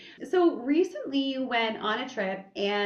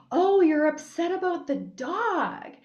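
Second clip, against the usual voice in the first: high, slow, drawn-out mom sympathy; consoling mother; 8 semitones higher, 5 dB louder than usual. Retold or imitated. Mom rubs crying daughter's back, exaggerated sympathy. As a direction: coo it as a mother who just understood. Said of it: "rubbing my back and she's like".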